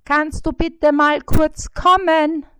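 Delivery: high, loud fly's call. A narrator's reading. Fly Bissi calls the spider, high and loud. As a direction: shout up to the spider, shrill and small.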